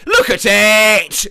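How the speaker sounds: drawn-out, loud boast; slow and loud, show it off; loud proud look. Acted. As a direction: hype up the lasagna, slow and loud. Showing off homemade lasagna.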